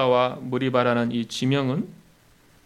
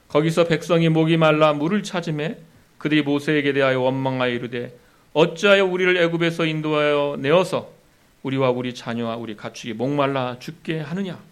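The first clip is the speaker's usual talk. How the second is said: raised, loud reading of the verse; solemn Bible reading, louder; scripture reading; 5 dB louder than usual. Acted. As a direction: read the passage out to the congregation. Reads Exodus passage aloud.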